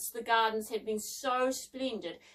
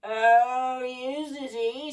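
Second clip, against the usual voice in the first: slow, menacing scratchy snarl; sneering mechanical giant; 7 dB louder, much slower than usual. A narrator's reading. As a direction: snarl it, loud at first, then easing. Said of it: "snarled the scratchy voice".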